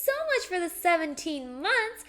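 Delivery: high, loud, gushing shout-out; excited thanks. Real, not acted. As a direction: celebrate a supporter with a high, loud cheer. Thanking a subscriber on stream.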